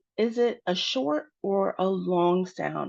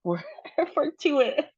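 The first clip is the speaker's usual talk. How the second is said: high, laughing stumble on fortuitous; laughing at own slip; 9 semitones higher than usual. Real, not acted. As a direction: break into a real laugh mid-word. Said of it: "Sorry, I cannot pronounce".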